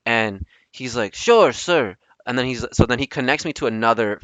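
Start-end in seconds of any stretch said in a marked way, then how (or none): 1.1-2.0 higher, louder mock Filipino accent; Filipino caller accent. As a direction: agree like a Filipino call-center agent. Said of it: "a Filipino dude".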